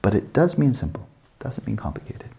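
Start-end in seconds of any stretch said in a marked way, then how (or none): 1.4-2.3 whispered aside. Real, not acted. Teacher tossing off an aside to students, whispered. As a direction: whisper it, much softer. Quick aside after "simple.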